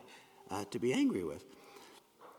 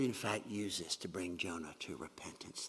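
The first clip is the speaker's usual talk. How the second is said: hushed, breathless aside; whispered; 9 semitones lower, 10 dB quieter than usual. Real, not acted. Preacher drops to a whisper mid-point to the congregation. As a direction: drop to a whisper.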